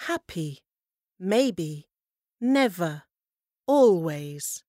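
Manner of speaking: "Mother, father, knowledge", each punched on its first syllable; word list from "Mother" to "always", strong start and falling end on each; first-syllable stress. Shown as a demonstration. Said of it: "sur la première syllabe".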